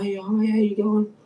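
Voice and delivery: hammed-up friendly shopkeeper greeting. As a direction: mimic his cheery hello. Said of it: "he was real friendly with me".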